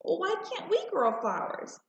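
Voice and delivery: put-on high, wistful little girl asking; child's question. A narrator's reading. Girl asking her mother for flowers, high and wistful. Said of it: "I asked".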